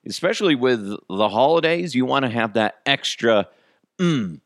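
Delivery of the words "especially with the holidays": usual speaking voice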